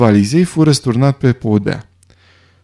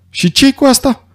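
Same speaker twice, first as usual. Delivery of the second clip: sharply higher defensive question; startled, touchy; 13 semitones higher than usual. A narrator's reading. Lombard asked about his revolver, jumping in defensively. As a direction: jump in defensively, pitched well up. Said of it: "sări ca ars Lombard".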